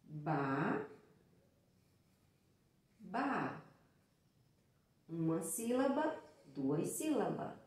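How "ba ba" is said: "ba-, ba" with a clear pause between the two syllables; split into syllables. Shown as a demonstration. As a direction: sound out "ba-" then "ba" one syllable at a time, for children. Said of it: "iria ficar".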